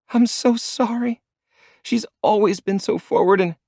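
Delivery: ashamed, close to tears; tearful apology. A narrator's reading.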